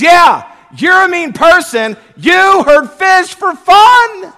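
high, loud, slow accusing shout; wife accusing. Retold or imitated. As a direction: shout it at him like a scolding wife. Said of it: "She says".

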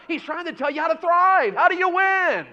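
high, loud pulpit cry; fiery preaching. Acted. Pastor rousing the church, loud and high. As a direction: lift it to the rafters.

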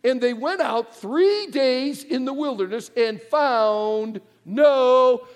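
ordinary talk, nothing marked

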